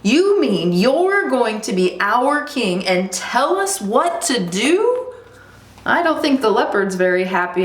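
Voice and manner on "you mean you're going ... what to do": high, rising challenge; incredulous leopard. Acted. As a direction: question it slowly, climbing in disbelief. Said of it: "exclaimed the leopard".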